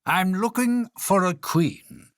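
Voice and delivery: king's announcement. Acted. King advertising in magazines for a wife, pompous. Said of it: "and said".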